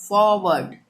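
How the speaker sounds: "फॉरवर्ड" loud, as "faw-wud"; British pronunciation. Shown as a demonstration. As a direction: say "फॉरवर्ड" firmly without either r. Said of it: "इसका सही pronunciation होगा". ("फॉरवर्ड" ज़ोर से, "फॉवड")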